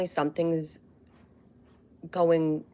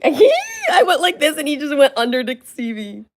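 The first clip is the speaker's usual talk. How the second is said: higher and louder through laughter; laughing; 8 semitones higher, 9 dB louder than usual. Real, not acted. Owner laughing as the dog peeks under, laughing. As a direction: laugh while telling it.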